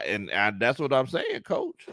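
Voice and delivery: normal talking voice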